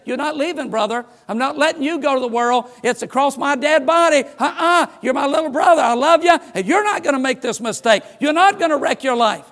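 pleading brother. Retold or imitated. Older brother stopping the prodigal, urgent loud plea. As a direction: block the door and beg him to stay. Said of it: "lay across the doorway and say".

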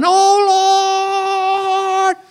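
high, loud cry while falling; panicked yell. Retold or imitated. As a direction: yell it out as he falls off the cliff. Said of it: "he was yelling".

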